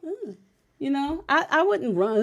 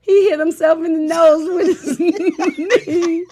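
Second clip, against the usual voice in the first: laughing through the story, louder; giggling as she tells it; 5 semitones higher, 6 dB louder than usual. Real, not acted. Mother laughing as she tells of son's knee.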